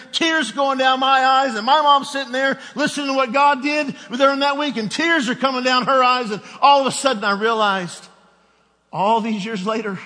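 high, loud preaching climax, near shouting; full-force preaching. Acted. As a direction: build the story to a fiery peak.

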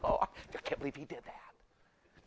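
hushed mock-shocked woman; soft, slow, exaggerated sympathy; women gossiping. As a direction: imitate a friend drawing out scandalized disbelief. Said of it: "they mirror each other like".

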